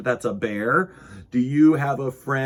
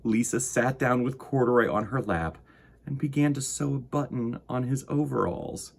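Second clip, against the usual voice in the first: gentle storybook narration for little listeners; reading to children. A narrator's reading. Host reading Corduroy to young viewers, gentle storytelling. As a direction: read it like bedtime story time. Reading picture book aloud.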